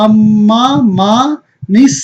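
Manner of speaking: আম্মা মা নিস very drawn out, piece by piece; slow syllable-by-syllable demo of আম্মা মা নিস; joined reading. Shown as a demonstration. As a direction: stretch আম্মা মা, then link into নিস with an "n". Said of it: "তাহলে কি হবে?".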